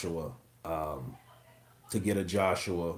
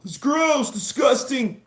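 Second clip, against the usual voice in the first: high, squeamish mocking voice; grossed-out viewer; 5 dB louder, slower than usual. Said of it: "I know that kinda freaks a lot of people out".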